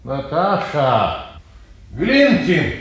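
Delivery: long drawn-out call. Acted. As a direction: call slowly into an empty house. Calling for people in empty house. (протяжно зовёт)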